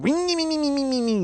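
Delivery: high engine whine sliding downward; dragster imitation. Comic mocking a singer as a dragster, whining engine noise. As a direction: rev like a racing car through your nose. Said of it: "sound like a dragster rolling".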